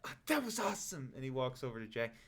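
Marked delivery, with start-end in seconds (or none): 0.0-1.1 higher, giddy goblin voice, lightly voiced; a breathless little goblin gushing; excited goblin. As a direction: goblin thanking the ogre after the maze, higher voice. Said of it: "And the goblin's like".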